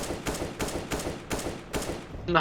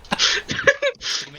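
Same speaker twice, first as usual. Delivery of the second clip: wheezing laugh; 4 dB quieter than usual. Real, not acted. Breathless laughter continues.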